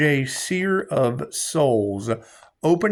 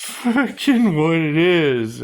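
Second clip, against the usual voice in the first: drawn-out emphatic; 3 semitones higher, 5 dB louder, much slower than usual. Real, not acted. Catchphrase drawn out.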